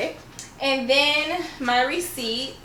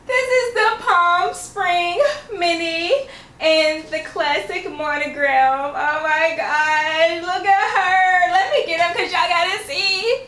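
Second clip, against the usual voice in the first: higher and louder, slow and tearful; thrilled, near tears; 7 semitones higher, 4 dB louder than usual. Real, not acted. Showing the bag to her viewers, tearfully thrilled. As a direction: gush over it, barely holding it together. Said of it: "I'm so, so excited".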